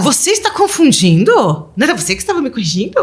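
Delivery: put-on playful told-you-so jab at the children; mock teasing. Mother teases confused children back. (provocação brincalhona, mais aguda e rápida)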